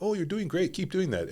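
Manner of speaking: high, bright mock encouragement; coach's cheerful praise. A jiu-jitsu coach praising a student mid-roll, high and upbeat. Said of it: "he's like".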